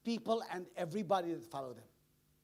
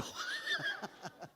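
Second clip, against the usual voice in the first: high laugh; 8 dB quieter than usual. Real, not acted.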